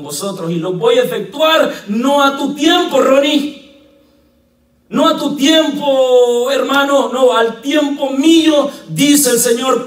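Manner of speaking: high, loud pulpit shout; full-force preaching. Acted. Pastor shouting God's promise to the church, full-force preaching. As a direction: thunder it out to the congregation.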